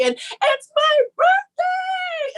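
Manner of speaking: high, slow, shrieked birthday announcement; excited birthday scream. Retold or imitated. To the producers, a shrieked birthday squeal. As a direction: squeal it out at the top of the voice.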